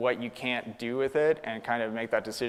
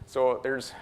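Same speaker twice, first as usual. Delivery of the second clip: normal talking voice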